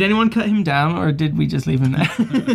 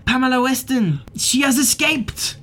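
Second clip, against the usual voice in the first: put-on high, nervous French accent; jittery Frenchman with a French accent; Lucien Leblanc; 7 semitones higher than usual. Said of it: "He looks very nervous".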